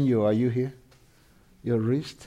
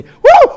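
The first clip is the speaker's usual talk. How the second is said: sudden whooping cry, much higher and louder; high whoop of praise; 14 dB louder than usual. Real, not acted. Preacher whooping for joy at the congregation. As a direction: let out a joyful whoop.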